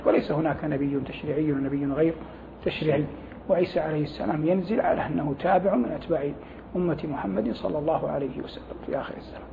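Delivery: ordinary talk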